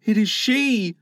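high, amazed exclamation; excited cry. Acted. Nibs spots Wendy moving, excited cry. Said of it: "he cried".

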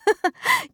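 a quick bright rising giggle; high giggle. A narrator's reading. Cindy laughing at Jeff's canoe idea. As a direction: giggle up at him. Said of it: "Cindy laughed".

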